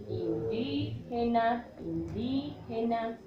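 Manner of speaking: "indígena" slowly, singsong, with a long "díí"; stretched stressed syllable. Shown as a demonstration. Teacher shows pupils where the tilde goes, "indígena" with drawn-out "dí". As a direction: hold the accented I in "indígena" each time. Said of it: "Y decimos".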